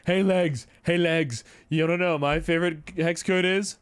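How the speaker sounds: teasing sing-song call, drawn out; taunting. Retold or imitated. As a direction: goad him with a slow taunting chant. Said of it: "and I was like".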